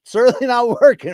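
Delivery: laughs through it, high and loud; laughing at his failed mustache trim. Real, not acted. To the chat about his mustache, laughing.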